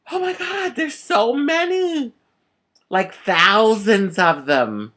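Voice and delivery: high, slow, rising amazement; awestruck. Retold or imitated. Fortune teller tells the child of many men, amazed. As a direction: marvel at it, drawn out.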